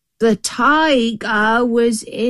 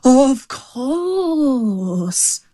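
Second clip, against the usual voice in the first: high, drawn-out mock fright; hammed-up trembling agreement; pretended fright; 6 semitones higher than usual. A narrator's reading. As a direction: feign fear of the tiger. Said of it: "pretending to tremble with fright".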